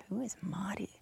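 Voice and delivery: whispered, puzzled question in an Australian voice; confused whisper. Retold or imitated. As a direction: whisper it like you're lost. Retelling confused reaction to call.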